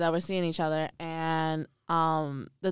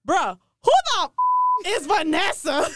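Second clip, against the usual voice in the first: incredulous yell; 9 semitones higher, 8 dB louder than usual. Retold or imitated. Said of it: "he says".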